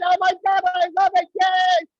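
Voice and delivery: high, loud gleeful cheering; excited shouting. Real, not acted. Comic cheering the crowd's music battle, loud high whoops. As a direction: whoop with delight over the noise.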